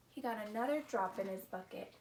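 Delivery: ordinary delivery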